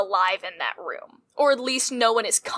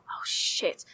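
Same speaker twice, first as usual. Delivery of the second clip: a voiceless hushed curse; muttered oh shit; 7 dB quieter than usual. Acted. Server quietly cursing to a coworker on seeing the problem. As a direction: swear under your breath. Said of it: "I go".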